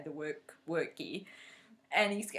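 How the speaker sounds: usual speaking voice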